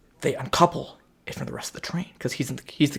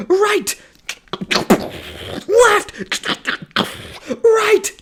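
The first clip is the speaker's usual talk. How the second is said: mock shouted steering calls; 4 dB louder, much slower than usual. Steering train across ice.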